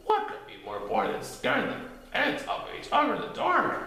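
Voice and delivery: high airy critter voice; a bouncy little voice, breathed out; Titter. A narrator's reading. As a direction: Titter agreeing eagerly.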